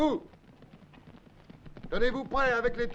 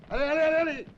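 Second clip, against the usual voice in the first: shouting; 4 semitones higher, 4 dB louder, much faster than usual. Acted. Urging men to hurry out.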